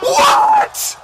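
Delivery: high shocked yell of disbelief; shocked shout. Real, not acted. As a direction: scream it in disbelief. Streamer reacts to clutch.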